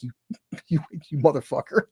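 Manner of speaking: sputtering through laughter at a friend; can barely get the insult out for laughing; mock outrage cracking into laughter. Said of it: "I'm laughing so fucking hard".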